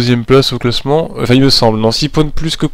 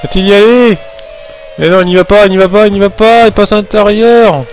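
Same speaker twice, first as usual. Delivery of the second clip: excited, higher race play-by-play; motorsport commentator; 7 semitones higher than usual. Pretend. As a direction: build the suspense of a photo finish. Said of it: "On va faire monter le suspense".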